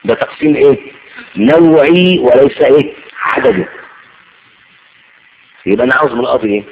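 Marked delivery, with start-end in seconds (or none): 1.3-2.9 "نوعي" drawn out slowly and loudly; تأكيد على نوعي. Shown as a demonstration. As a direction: hit "نوعي" hard, stretching it before the question. Teacher hammers home the key term. (تشديد ومدّ بطيء عالٍ على «نوعي»)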